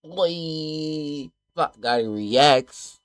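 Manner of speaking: loud, high YouTuber intro; hype intro. Acted. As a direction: shout the channel greeting. Channel intro.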